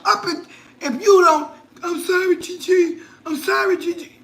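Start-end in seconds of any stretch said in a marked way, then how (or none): 1.8-4.1 whiny granddaughter. Retold or imitated. Granddaughter voiced apologizing to her.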